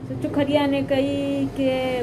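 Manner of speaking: ordinary delivery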